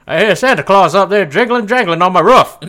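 loud, high mock Southern holler; hammed-up yokel complaining about the roof; hollering country homeowner. Joins the Southern roof bit.

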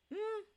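short high "hmm"; high musing hum; pondering hum. Acted. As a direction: hum high while thinking it over. Dog thinks to himself.

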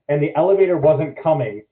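ordinary talk, nothing marked

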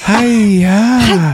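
high loud mock kiai; karate yell. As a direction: shout it like a karate chop.

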